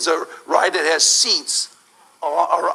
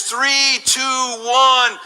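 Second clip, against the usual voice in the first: suspense countdown; 6 semitones higher, much slower than usual. Acted. Countdown before drop.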